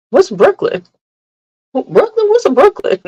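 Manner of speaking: high, mock-baffled questions; feigned ignorance. Jokingly denying her child to viewers, high feigned confusion. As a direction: play dumb in a high-pitched voice.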